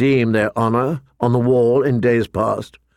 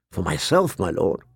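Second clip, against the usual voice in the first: lower, softer reply; subdued young steward; 6 semitones lower, 4 dB quieter than usual. A narrator's reading. Steward questions his lord's order, subdued. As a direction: answer your lord with quiet uncertainty. Jon's hesitant query to commander.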